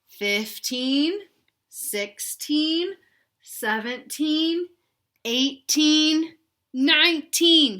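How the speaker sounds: put-on rising excited count for kids; counting up together. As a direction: build each number to a big finish. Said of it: "Count with me".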